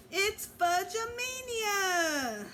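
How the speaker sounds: high, slow drawn-out shriek. A narrator's reading. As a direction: shriek it in wild delight. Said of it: "She raced off shouting".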